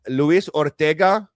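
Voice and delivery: put-on slow, newsreader-style Spanish accent on "Luis Ortega", rising at the end; a reporter hamming up a Spanish accent on "Luis Ortega"; mock Spanish accent. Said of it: "news reporters have to put on".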